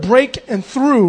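"break and through" said slow, each part stressed; split the word. Shown as a demonstration. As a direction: pull "break" and "through" apart slowly. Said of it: "even in the word".

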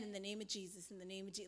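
silent inner prayer. Retold or imitated. Facing a robber on the bridge, praying under her breath, hushed. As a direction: pray it inwardly, almost no sound. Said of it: "I'm just in my head".

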